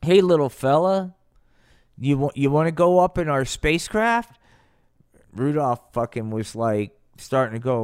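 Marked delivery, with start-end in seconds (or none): 0.0-4.4 lightly voiced, higher alien invitation; coaxing space alien. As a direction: lure the little one, a bit raised. Alien coaxes little Rudolph aboard.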